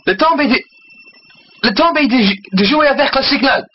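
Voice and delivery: high, insistent French; French captain. Acted. Captain's French line, acted.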